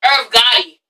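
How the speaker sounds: put-on American pronunciation with a sounded R; American accent. Shown as a demonstration. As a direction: switch to an American accent for the name. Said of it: "say that in an American accent".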